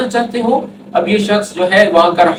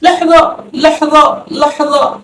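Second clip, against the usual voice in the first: higher, fading لحظہ repeated; imitating a startled cry; 7 semitones higher, much slower than usual. Shown as a demonstration. Teacher mimicking the guard's startled stop. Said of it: "ایسے چونکا کر جو ہے reaction".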